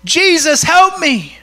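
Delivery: high, desperate cry for mercy. Acted. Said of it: "he shouted all the more".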